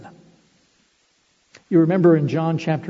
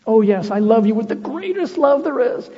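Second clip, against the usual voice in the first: higher, louder overblown boast; mock boastful declaration; 6 semitones higher, 4 dB louder than usual. Preacher mocking the boast Peter no longer makes, hammed-up. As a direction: ham up a grand claim of love.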